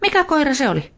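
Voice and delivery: put-on high, bright girl's question; curious girl. A narrator's reading. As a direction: Veera asking, pitched well up. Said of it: "Veera sanoi".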